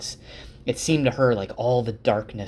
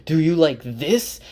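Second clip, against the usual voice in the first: louder, higher angry yell; 5 semitones higher, 5 dB louder than usual. Retold or imitated. Rand, showing off his power to Egwene, yells in anger. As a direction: hurl the question at them. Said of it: "And he yells".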